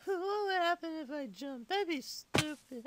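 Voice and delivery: ordinary talk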